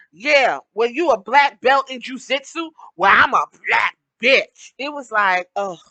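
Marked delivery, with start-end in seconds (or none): 0.1-4.7 loud mock of Brandi's tough talk; hammed-up wrestling trash talk; quoting Brandi's promo. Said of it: "everyone is like, the last part of this promo".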